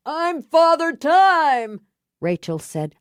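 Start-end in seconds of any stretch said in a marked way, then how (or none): 0.1-1.8 put-on high, slow, drawn-out drunken shout. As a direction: bellow it like a deranged drunk on the street. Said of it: "the drunk with the clock shouted".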